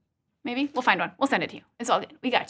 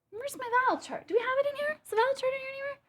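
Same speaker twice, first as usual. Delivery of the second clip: muttering to herself; 11 semitones higher, 8 dB quieter, much faster than usual. Real, not acted.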